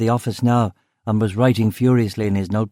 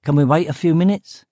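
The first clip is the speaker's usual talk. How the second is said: higher-pitched East End question; East End accent; 6 semitones higher than usual. A narrator's reading. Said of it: "said in an East End accent".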